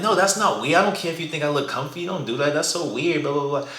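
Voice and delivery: high, mock yell of an annoyed girl; imitating a girl yelling at a stranger; yelling at him. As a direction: fire it back at him, indignant. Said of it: "yelling at him".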